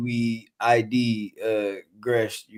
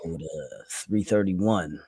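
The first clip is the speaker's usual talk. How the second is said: normal talking voice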